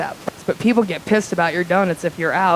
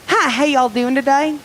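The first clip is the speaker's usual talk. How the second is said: chirpy Southern caller, higher pitched; Louisiana lady caller; 5 semitones higher, 4 dB louder than usual. Acted. Lady phoning a donut shop from Louisiana, chirpy Southern accent. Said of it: "this lady's like".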